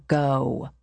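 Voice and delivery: low, firm paternal command; firm order. A narrator's reading. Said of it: "his father's voice was firm".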